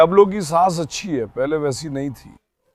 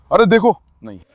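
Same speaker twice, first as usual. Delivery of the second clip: louder alarmed no; sharp warning call; 2 semitones higher, 7 dB louder than usual. Real, not acted. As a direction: stop them before they clash. Stopping a dog fight.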